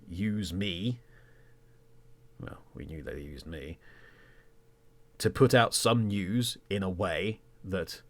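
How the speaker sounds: usual speaking voice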